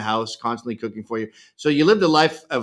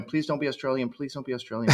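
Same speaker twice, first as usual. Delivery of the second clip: soft, hushed mock plea; pleading murmur; 6 dB quieter, faster than usual. Podcast guest retelling hearing a new band, hushed plea. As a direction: mutter a small hoping prayer under the breath. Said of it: "And I caught myself going".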